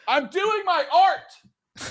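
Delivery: high, drawn-out theatrical cry; stretched, high-pitched mock indignation; mock artist's protest. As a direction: protest slowly in a high, hammy voice. Mock protest to complaining wife.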